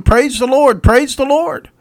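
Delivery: high, loud, acted exultation; jubilant praise. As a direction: cry it out as if jumping and clapping. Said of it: "jumping up and down and clapping her hands".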